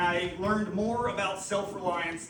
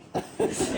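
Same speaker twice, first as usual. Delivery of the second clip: breathy laugh. Real, not acted. Laughing at the steak quote.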